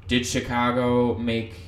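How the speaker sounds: ordinary talk, nothing marked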